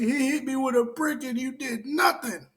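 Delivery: very high mock-tearful accusation. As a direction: imitate her crying out blame, pitched far up. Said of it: "He's like".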